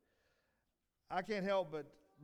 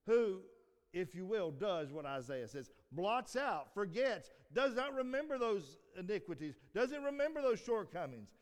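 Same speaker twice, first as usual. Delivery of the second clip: louder, higher preaching build; preaching climax; 6 semitones higher, 6 dB louder than usual. Acted. Preacher urging the congregation to forgive, in full preaching voice. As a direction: ring it out with conviction.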